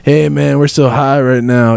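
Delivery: laid-back stoner dude. Pretend. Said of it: "Stone Town".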